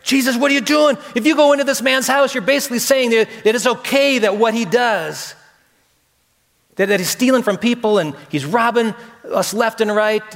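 hammed-up crowd protesting in alarm. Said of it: "I know the people are afraid".

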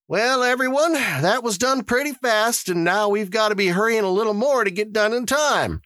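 high-pitched official addressing the crowd; high character voice for announcements; Mister Summers, the lottery official. A narrator's reading. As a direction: voice an organizer moving everyone along.

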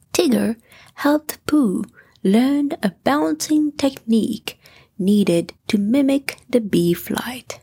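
ordinary delivery

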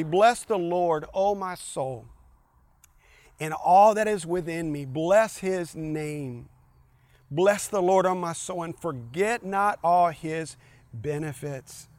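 preaching proclamation. Shown as a demonstration. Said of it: "proclaiming scripture".

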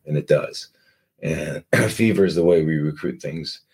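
usual speaking voice

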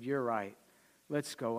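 a hushed, meek husband giving in; quiet, sheepish yes; meek farmer agreeing. Acted. Farmer quietly agreeing with his wife, quiet and sheepish.

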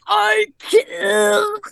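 high, choked mock gasp; breathless wheeze. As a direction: strain it out as if airless. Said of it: "and, like, wheezing".

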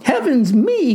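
put-on high-pitched girl's dismay; theatrical high cry. A narrator's reading. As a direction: exclaim it like an affronted girl. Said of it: "cried the girl".

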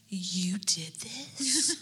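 slow whispered "You did this?"; awed child whisper. Retold or imitated. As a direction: breathe it like an amazed kid. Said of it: "Wyatt was like".

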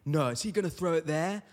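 excited Australian bloke, mock amazed; overhyped Australian rugby fan reaction; Aussie rugby fan. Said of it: "they'll be like".

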